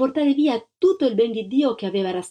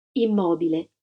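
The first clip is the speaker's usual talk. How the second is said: ordinary delivery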